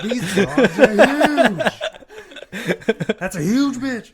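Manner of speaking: laughing mock shout. Mock settlers gawking at islanders.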